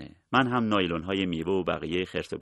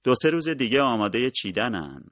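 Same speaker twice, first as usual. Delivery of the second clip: louder and higher, calling across the field; 6 semitones higher, 5 dB louder than usual. A narrator's reading. Husband in the field calling to his wife, loud. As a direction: raise your voice to reach her. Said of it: "با صدای بلند گفتم".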